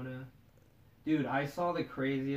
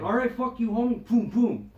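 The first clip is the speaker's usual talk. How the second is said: loud, raised mock taunt with punch noises; punchy, high-energy fight reenactment; retold fight talk; 7 semitones higher, 7 dB louder than usual.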